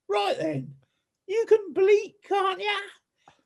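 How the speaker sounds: high put-on Essex accent, explaining the plan; Sarah's Essex accent. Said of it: "do her accent for you".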